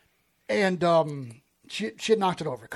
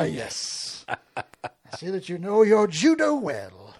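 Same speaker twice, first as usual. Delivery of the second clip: quoting a clip; 4 dB louder, slower than usual. Retold or imitated. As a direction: savour each word like a famous quote.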